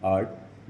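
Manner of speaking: आर्ट said as "aat", with no r sound; silent r demo. Shown as a demonstration. Teacher showing students the silent r in आर्ट. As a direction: model आर्ट with a long a and no r. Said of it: "वो silent है".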